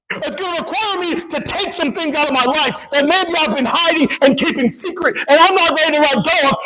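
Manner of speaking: high, building pulpit list; impassioned preaching. Real, not acted. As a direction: pile up each cost with rising urgency. Preaches the cost.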